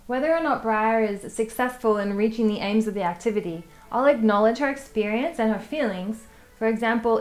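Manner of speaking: ordinary talk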